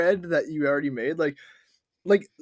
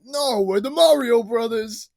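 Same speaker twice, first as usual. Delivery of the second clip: raised, louder, drawn-out mock reply, no cartoon accent; slow it down, higher and insistent; Mario Brothers; 7 semitones higher, 5 dB louder than usual. Co-host as the brothers answering doubts, accent dropped. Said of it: "cuts away from the commercial".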